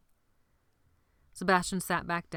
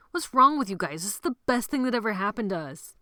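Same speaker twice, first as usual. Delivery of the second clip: put-on louder, higher, pressing the scared boys; exasperated; 4 semitones higher, 5 dB louder than usual. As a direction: chide them for looking scared, higher and louder. [name] scolds scared boys.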